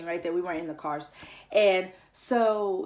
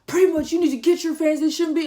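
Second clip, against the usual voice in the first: loud, higher scolding shout; yelling; 8 semitones higher, 9 dB louder, faster than usual. Retold or imitated. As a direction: blame her for her fans, loudly. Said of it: "Who are you yelling at?".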